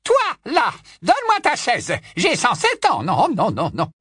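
put-on high, loud imperious old woman; high loud old aunt commanding a young cousin; bossy old aunt. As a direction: order him out of the chair from way up high. Muriel demanding a chair.

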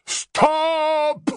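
a loud, ringing stop; thundering command. A narrator's reading. Harry as Runcorn halting the whole hall, thundering. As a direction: boom it across the hall. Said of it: "tonna Harry".

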